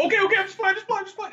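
high, rapid pleading; panicked pleading. Retold or imitated. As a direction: beg frantically in a mock panic. Retelling a panicked plea while running.

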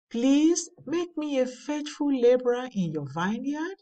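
ordinary talk, nothing marked

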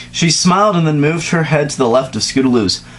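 ordinary delivery

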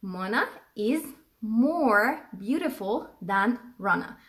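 very slow, drawn-out example; teacher modelling the sentence slowly; slow dictation. Shown as a demonstration. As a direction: stretch every word for learners. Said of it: "أو هقول".

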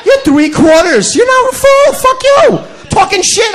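hammed-up shout; mock shouting. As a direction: yell it up high at her. Shouting at the amputee.

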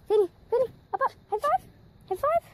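high excited pet voice; squeaky praising a dog. Real, not acted. Excited dog talk, cry.

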